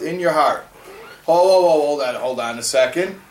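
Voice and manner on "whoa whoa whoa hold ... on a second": loud "whoa" to halt everything; mock objection. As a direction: cut in to stop the claim, then settle. Acted objector interrupts the verse.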